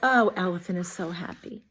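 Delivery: ordinary delivery, nothing marked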